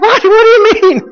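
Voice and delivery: very high-pitched mock disbelief; incredulous. Son reacts to dad still being mad, high mock incredulity. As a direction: squeal it in exaggerated disbelief. Retold reaction to dad's grudge.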